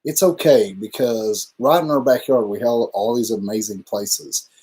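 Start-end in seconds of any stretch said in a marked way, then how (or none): 0.0-0.7 self-encouraging. Retold or imitated. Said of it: "And then I was like".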